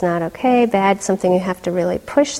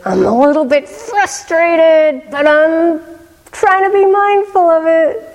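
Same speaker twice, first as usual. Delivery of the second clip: put-on higher, earnest teenage voice, holding it together; a teen admitting frustration, pitched up and earnest; voicing the teen boy; 8 semitones higher, 6 dB louder than usual. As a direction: quote the boy a little higher, trying to stay calm. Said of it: "And then he said".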